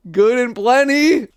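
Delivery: high, loud, slow mocking repeat; mocking disgust. Real, not acted. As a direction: sneer the name back.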